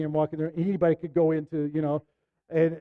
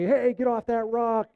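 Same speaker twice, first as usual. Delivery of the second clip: mild mock yell, pitched up and slowed; retold lifeguard yell; 6 semitones higher, slower than usual. Lifeguard telling the speaker off the rock, mild mock yell. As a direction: call it like a lifeguard half-heartedly. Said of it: "The lifeguard's yelling at me".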